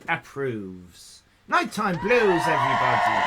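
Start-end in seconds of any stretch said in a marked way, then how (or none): 1.5-3.2 excited shout-out. Real, not acted. Shout-out to a viewer.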